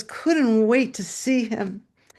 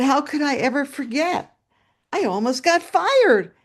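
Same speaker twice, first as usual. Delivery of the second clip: higher, louder mock dismay; animated, raised-pitch recollection; retold exclamation; 5 semitones higher, 4 dB louder than usual. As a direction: exclaim it with storyteller's emphasis. Teacher recalls the scare.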